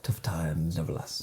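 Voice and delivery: slow, hushed whisper of a motto. Pretend. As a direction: breathe it out slowly like a secret. Quoting the meme dramatically.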